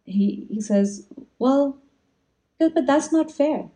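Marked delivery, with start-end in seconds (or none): 1.4-3.7 high, slow imitation of a child pondering; thoughtful child. Boy answering a strange offer from customers, thoughtful. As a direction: object gently, weighing it as a small boy would. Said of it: "very thoughtfully".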